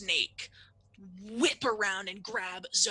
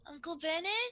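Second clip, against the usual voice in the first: put-on high, questioning child voice; small child calling; 9 semitones higher than usual. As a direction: call out uncertainly as a little kid. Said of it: "And you hear".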